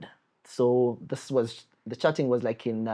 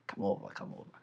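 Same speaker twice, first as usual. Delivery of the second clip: soft whisper, come over; whispered invite; 15 dB quieter, faster than usual. Retold or imitated. Telling friends how he invited her in, whispered. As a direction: whisper so nobody hears. Inviting girl while home alone.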